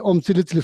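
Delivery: Om Sili Sili with s in place of k; mispronounced mantra. Retold or imitated. Man showing the power of his wrong mantra, S for K. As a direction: chant "Om Sili Sili" as om si-li si-li. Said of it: "That's incorrect way to pronounce".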